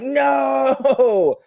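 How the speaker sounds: long, loud, high wail of no; hammed-up cry of despair; mock anguish. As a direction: wail it out like a dramatic defeat. Acting out crushed guildmate's reaction.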